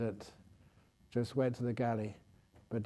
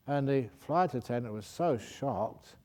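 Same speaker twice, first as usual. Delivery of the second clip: ordinary delivery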